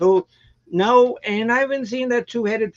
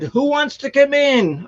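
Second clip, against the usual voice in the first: loud call to the cats, pitched up; 7 semitones higher, 5 dB louder than usual. Retold or imitated. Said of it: "And so I said".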